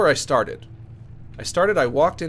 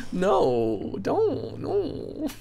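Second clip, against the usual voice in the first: mock scared squeal; 12 semitones higher, much slower than usual.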